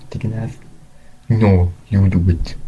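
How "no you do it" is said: ordinary delivery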